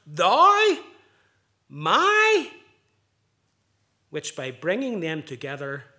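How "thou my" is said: "thou" and "my" each pitched high and loud, set apart; contrast thou and my. Shown as a demonstration. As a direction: punch "thou", then "my", as opposites. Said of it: "intensely vivid contrast between those two words".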